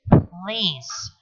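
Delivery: raw, vulnerable please to his sister. A narrator's reading. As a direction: let the plea show through. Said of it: "the plea is there in his voice, raw and vulnerable".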